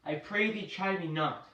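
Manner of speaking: ordinary delivery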